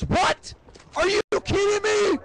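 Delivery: high-pitched mock outrage; outraged dad. Dad hears of the affair from his son, high incredulous outburst. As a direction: screech it in disbelief as a shocked father.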